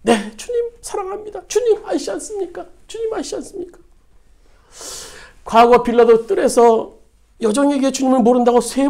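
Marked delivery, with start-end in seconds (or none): 0.0-3.8 tearful answer. Acted. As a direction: voice breaking, higher and softer, near tears. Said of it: "베드로가 울먹이면서 대답했다고". (울먹이며 높고 여린 목소리로)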